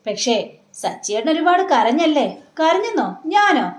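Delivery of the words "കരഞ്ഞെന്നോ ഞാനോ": higher, surprised denial; indignant denial. Retold or imitated. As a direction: act offended that anyone says he cried. Husband denies having cried.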